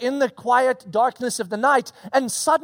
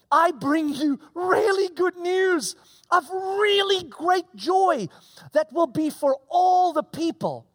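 bright, high angel announcing good news; voicing the angel; 4 semitones higher, slower than usual. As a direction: proclaim it like a messenger from heaven.

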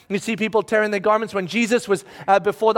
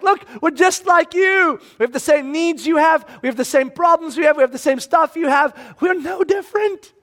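high, raised-voice pleading; Paul's insistent appeal; 6 semitones higher than usual. Acted. Paul appealing to the crowd as equals, insistent raised voice. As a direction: press the point on the crowd. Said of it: "And so he's like".

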